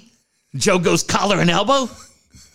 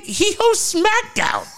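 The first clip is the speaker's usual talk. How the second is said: incredulous; 14 semitones higher, slower than usual. Retold or imitated. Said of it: "I'm like".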